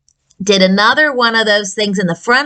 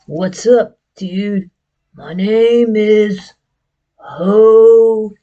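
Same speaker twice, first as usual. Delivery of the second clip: puppet voice; much slower than usual. Acted. Puppet Jose introduces himself.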